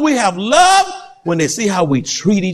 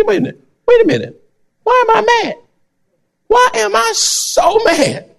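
self-questioning; 13 semitones higher than usual. Pretend. Preacher acts self-questioning.

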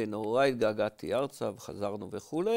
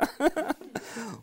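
amused laugh; 12 semitones higher than usual. Real, not acted. Laughs at not knowing.

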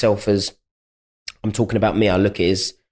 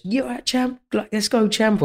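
high, eager fan's call; cheering fan; 10 semitones higher than usual. Retold or imitated. Stranger hails the fighter mid-jog, high and eager. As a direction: mimic a pumped-up stranger shouting encouragement.

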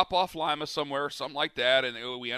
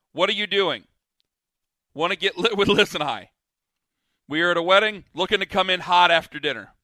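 reading texts aloud; 2 semitones higher, 8 dB louder than usual. Retold or imitated. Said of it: "You said".

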